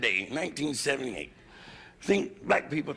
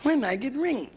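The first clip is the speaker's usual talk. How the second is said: pleading wife's broken English, pitched up high; wife nagging, higher; 9 semitones higher than usual. Retold or imitated. Nagging wife whines for ring, pitched up high. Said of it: "she asked me, you know".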